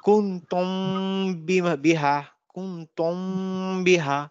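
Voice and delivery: কুনতুম বিহা with the মিম humming in the nose before বিহা; ikhfa with ghunna. Shown as a demonstration. Teacher repeating the example for students, nasal hum. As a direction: hum the মিম, then land cleanly on বিহা. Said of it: "মিমের উচ্চারণটা নাকের ভিতরে চলে যাবে".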